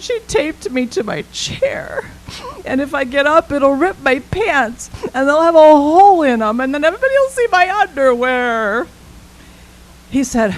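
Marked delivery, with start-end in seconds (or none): 0.0-8.9 put-on higher little girl, tumbling into panic; a kid fretting over ripped pants; worried child. Child explains her plight.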